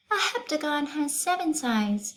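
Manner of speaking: high, lilting schoolteacher; teacher voice. Acted. Teacher explaining shapes to her class, high teacher voice. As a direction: voice Miss Zarves teaching the class.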